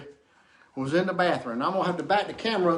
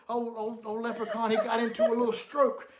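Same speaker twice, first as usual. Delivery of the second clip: normal talking voice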